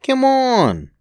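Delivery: put-on high child voice yelling after his brother; child's shout. Boy chasing his brother, a high yell. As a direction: call out high and loud like a boy. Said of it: "Stephen shouted".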